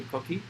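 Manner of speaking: quick high rising frog chirp; coqui frog call. Retold or imitated. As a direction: imitate the little frog's call. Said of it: "so the males do coqui".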